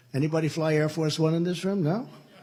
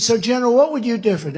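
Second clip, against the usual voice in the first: raised, higher call to the general; called out question; 8 semitones higher, 9 dB louder, faster than usual. Retold or imitated. President calling out to the general on the base, louder. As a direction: call over the noise to him.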